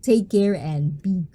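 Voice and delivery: normal talking voice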